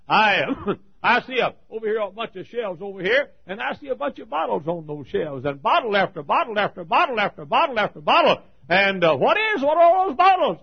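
loud and high, rushing, then a ringing question; preaching at full force. Acted. Preacher to his congregation, asking about the ringed men, preaching loudly. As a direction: cry it out over the crowd. Preacher describes the shelves of bottles.

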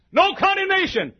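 high, loud preacher's proclamation; full-voiced pulpit cry; shout. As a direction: shout it like a triumphant verdict. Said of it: "as I analyze this shout".

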